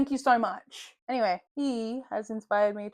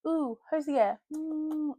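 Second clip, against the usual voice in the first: higher, slow cooing "ooh"; mock fangirl swoon; 6 semitones higher, much slower than usual. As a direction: coo over a crush, hammed up. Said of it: "I'm also gonna be like".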